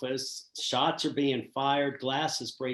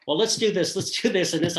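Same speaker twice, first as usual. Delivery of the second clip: higher and louder, mock giddy teenage chatter; a playful nod to excited teens, pitched up and louder; imitating excited girls; 7 semitones higher, 7 dB louder than usual. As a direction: bubble over like high school girls picking a password.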